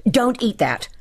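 mildly heated, forbid it sharply; angry order. A narrator's reading. Furious hostess snatches away plate.

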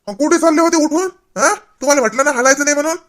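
put-on high, loud scolding of the old man. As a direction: tell him off for getting up. Grandson scolds grandfather for getting up.

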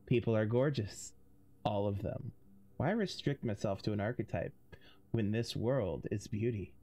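low, intimate purring voice. Acted. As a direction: purr it close, for one listener only. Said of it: "his voice lowered into an intimate purr".

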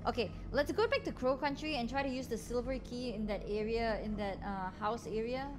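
ordinary delivery

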